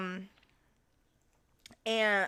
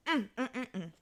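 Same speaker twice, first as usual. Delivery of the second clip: savoring hums; 3 semitones higher, 6 dB quieter than usual. Real, not acted. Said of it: "this shit is so good".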